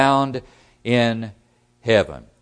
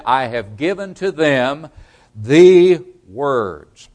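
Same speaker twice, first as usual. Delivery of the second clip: "the words" louder, set off by a pause; pause, then hit "the words" hard and drawn out; emphatic quote building to "the words"; stress on the words; 7 dB louder, slower than usual. Shown as a demonstration. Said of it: "Notice the emphasis on the words".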